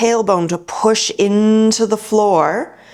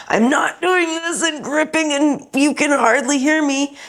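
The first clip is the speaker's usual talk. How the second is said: strained demo voice; 8 semitones higher than usual. Shown as a demonstration. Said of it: "you can hear in my voice".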